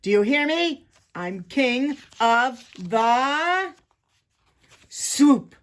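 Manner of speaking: loud, slow, high boast, building; drawn-out, overbearing villain shout; snarled. A narrator's reading. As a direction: proclaim it word by word like a bully king. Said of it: "he snarled".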